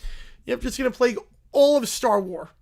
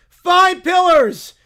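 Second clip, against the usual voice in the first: slow proud proclamation; 9 semitones higher, 13 dB louder, much slower than usual. Acted. Bear preacher proclaims his pillars.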